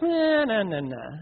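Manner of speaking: put-on drawn-out childish protest, falling; whining child. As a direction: imitate a kid fussing in refusal. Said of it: "And if they're like".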